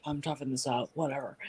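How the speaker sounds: low, quiet, stoic grumble; tough husband. Retold or imitated. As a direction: shrug it off like a Marine. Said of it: "he's a Marine".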